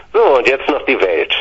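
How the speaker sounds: ordinary delivery, nothing marked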